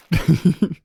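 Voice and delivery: high, loud chuckling burst; amused laugh. Real, not acted. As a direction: crack up briefly. Amused by praise of the impression.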